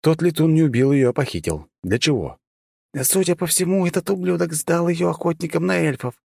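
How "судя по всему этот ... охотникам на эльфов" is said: hissed. Acted. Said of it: "прошипела драконитка".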